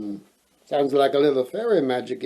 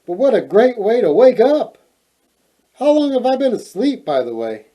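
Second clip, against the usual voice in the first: excited; 7 semitones higher, 5 dB louder than usual. Acted. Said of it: "she said".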